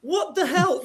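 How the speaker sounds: ordinary talk, nothing marked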